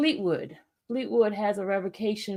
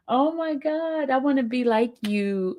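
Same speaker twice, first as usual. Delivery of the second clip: ordinary talk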